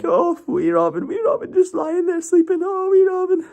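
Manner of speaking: put-on very high falsetto baby talk; friend's morning coo. Korean Ryan cooing at sleeping Robin in very high falsetto. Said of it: "he'd be like".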